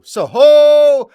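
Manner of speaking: high, loud drawn-out hunting cry; hunting call. Shown as a demonstration. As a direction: holler it like a hunter's call. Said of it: "used to be this cool".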